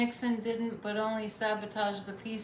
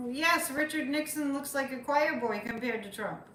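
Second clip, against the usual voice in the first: usual speaking voice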